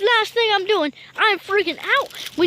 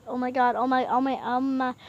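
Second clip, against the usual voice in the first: ordinary talk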